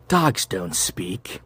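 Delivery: normal talking voice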